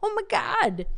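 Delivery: mock hype man, high and amazed. Research hype man wowing stakeholders, mock-amazed. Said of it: "they need the hype man to be like".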